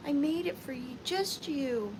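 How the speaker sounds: ordinary delivery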